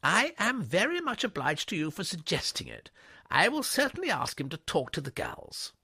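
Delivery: a brisk headmistress, pleased and grateful. A narrator's reading. Miss Tomlinson thanks Jeeves.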